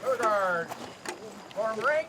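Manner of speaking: loud barked parade order; drill command. Real, not acted. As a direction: call the rank out sharply. Drill commands to color guard.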